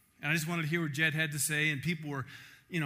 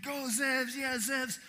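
high cheering chant; crowd cheering; 9 semitones higher, much slower than usual. Retold or imitated. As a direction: cheer him on like a campus crowd. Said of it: "people were saying".

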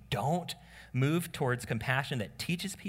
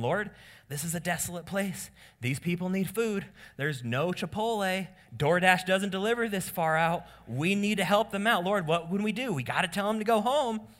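higher, worried plea to the Lord; anxious helpers pleading, pitched up; genuinely concerned disciples; 7 semitones higher, 4 dB louder than usual. Acted. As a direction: voice the disciples fretting over hungry crowds. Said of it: "Perhaps the disciples are genuinely concerned".

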